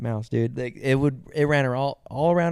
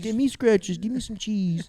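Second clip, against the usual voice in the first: high squeaky begging critter; higher cartoon critter begging; mouse voice; 8 semitones higher, slower than usual. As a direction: plead like a tiny mouse.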